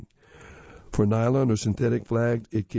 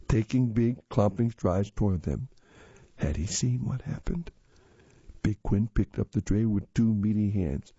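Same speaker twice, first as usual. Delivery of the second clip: ordinary delivery, nothing marked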